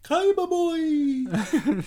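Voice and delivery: high, slow, hammy ghostly wail; flamboyant ghost voice. Retold or imitated. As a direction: stretch it out like a campy ghost. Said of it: "trying to do a flamboyant, stereotypical ghost voice".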